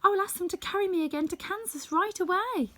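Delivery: put-on high, joyful girl's cry; joyfully. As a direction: burst out with delight, pitched high. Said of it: "said the child joyfully".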